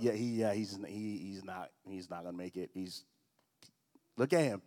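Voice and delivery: a hint of grave doctors, quietly; subdued retelling of doctors. Retelling the doctors' words at his son's birth, softly hushed. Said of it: "they're telling us".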